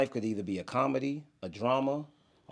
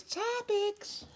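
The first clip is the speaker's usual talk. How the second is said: high sing-song topics. Real, not acted. Announcing the topics segment.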